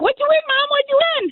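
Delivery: very high, eager child imitation; excited son. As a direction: imitate an excited kid pestering mom. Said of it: "My son is so excited".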